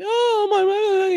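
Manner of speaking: high squawky hen voice; hen impression. Chicken voice imitation, Foghorn.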